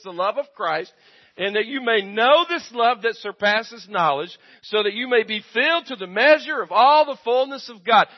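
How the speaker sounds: loud, high preaching of the verse; full-force preaching. Acted. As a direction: preach it, building to the top.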